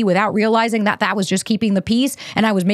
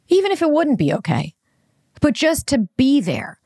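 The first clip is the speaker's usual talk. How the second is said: emotional outburst; 7 semitones higher than usual. Real, not acted. Remembering her dog's death, an emotional high plea. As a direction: pitch jumps high, pleading and strained. Upset at the absent boyfriend.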